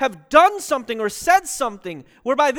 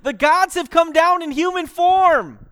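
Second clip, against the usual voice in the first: raised, higher and louder, crying out; retold crowd shout; 5 semitones higher, 8 dB louder than usual. Crowd proclaiming the gods have arrived, shouting. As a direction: call it out like an amazed crowd. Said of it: "They start shouting".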